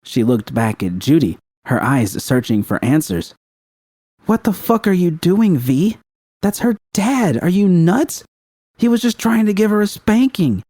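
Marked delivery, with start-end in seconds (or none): 4.2-10.6 high, incredulous yell; high furious disbelief; angry disbelief. Acted. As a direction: scold her in shocked anger. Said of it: "disbelief and anger".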